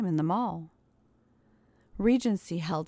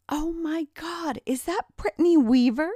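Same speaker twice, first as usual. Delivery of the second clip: high, loud mock-shocked outcry; crying out; 9 semitones higher, 7 dB louder than usual. A narrator's reading. Old schoolmate spotting Mike at the mall, crying out loudly. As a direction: cry out, stretched high at first and then louder. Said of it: "cried out Carrie".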